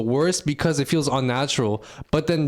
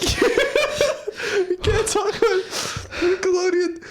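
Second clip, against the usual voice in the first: high, words broken by laughter; real laughter; laughing; 13 semitones higher, much slower than usual. Real, not acted. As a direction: barely get it out between laughs. Laughing fit mid-sentence.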